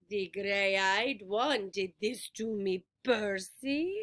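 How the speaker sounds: put-on slow, low pleading old woman; a hint of a poor grandmother. A narrator's reading. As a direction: ask for pity, slowly. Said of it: "Her voice invited me to look up".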